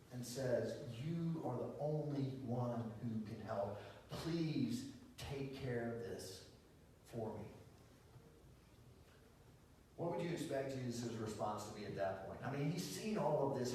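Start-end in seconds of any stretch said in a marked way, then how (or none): 0.9-7.5 slow-paced soft plea, with pauses; pleading. Acted. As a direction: plead quietly, dropping low at the end. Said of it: "she falls down on her knees in front of Jesus".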